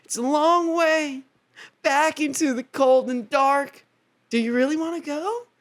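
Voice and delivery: high, earnest hero voice; gently hesitant lover boy; mock Orpheus. Orpheus warning Eurydice in a high mock voice. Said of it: "And Orpheus is like".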